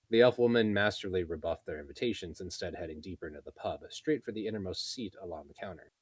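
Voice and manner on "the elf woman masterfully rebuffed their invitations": ordinary delivery, nothing marked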